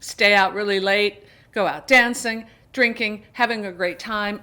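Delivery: normal talking voice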